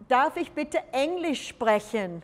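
darf ich bitte Englisch sprechen said slowly in German; slow, clear German for the class; German phrase. Shown as a demonstration. Teacher modelling darf ich bitte Englisch sprechen slowly in German. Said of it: "What might that be?".

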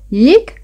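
high little mouse "eek"; startled squeak. A narrator's reading. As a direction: squeak as if jumping in fright. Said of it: "he squeaked with a jump".

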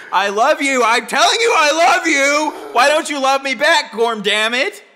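loud, high desperate pleading; pleading spouse. Retold or imitated. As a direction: beg them to love you back, loudly. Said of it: "and you try to say".

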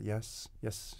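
quiet brisk dismissal; curt condescending yes; matter-of-fact. Shown as a demonstration. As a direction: brush it off briskly. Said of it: "very matter-of-fact".

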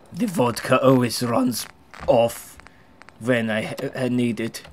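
halting mock Russian accent. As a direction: put on a totally fake Russian accent. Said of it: "Mister Totally Russian accent?".

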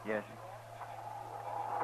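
soft whispered reply. Acted. Chester agreeing beside the body, whispered. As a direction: answer under your breath.

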